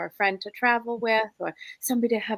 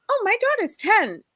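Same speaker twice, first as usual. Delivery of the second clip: mock surprise; 9 semitones higher than usual. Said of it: "She's like".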